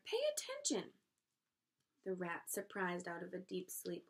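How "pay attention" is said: very high-pitched cry; screamed. A narrator's reading. Wilbur to the sleepy rat, high scream. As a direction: scream it high without much volume. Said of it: "screamed Wilbur".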